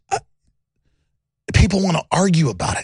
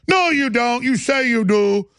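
imitating Bolling; 6 semitones higher, slower than usual.

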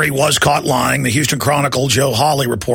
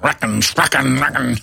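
a lightly voiced cartoon cowboy, muttered; a half-hearted Yosemite Sam try; Yosemite Sam imitation. Shown as a demonstration. Host offering listeners a Yosemite Sam imitation, half-hearted. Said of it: "Wanna hear a Yosemite Sam, uh, imitation".

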